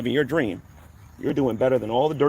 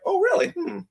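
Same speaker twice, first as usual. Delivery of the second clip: very high-pitched, put-on "oh, really"; mock surprise; 3 dB quieter than usual. Answering someone who comes in the room, mock surprise. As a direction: feign innocent interest in a high little voice.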